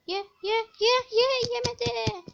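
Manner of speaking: high, loud chant that slows into yemedi; real excited pleading; excited urging. Real, not acted. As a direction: cheer it on, then groan. Urging the creature to eat.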